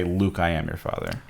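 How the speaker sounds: soft, slow misquoted Vader line; quiet misquote. Retold or imitated. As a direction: hush the wrong version, slowly. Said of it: "He doesn't say".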